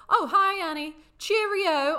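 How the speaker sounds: high, loud, slow-drawn mock greeting; over-eager impersonated hello; imitating a cheery greeter. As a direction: greet a friend with hammed-up cheer. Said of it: "If you approach me and go".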